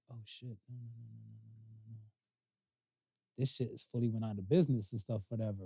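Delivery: very soft dismayed muttering; quiet disappointed mumble to yourself. Retold or imitated. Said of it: "I was like".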